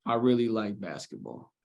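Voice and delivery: low, quiet, uninterested answer; mumbled with no energy; bored and flat. Shown as a demonstration. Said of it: "It doesn't really seem like I like basketball".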